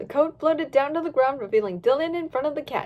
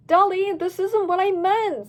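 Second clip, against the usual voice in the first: protesting voice; 6 semitones higher, 4 dB louder than usual. Acted. Dylan protesting, slow put-on voice.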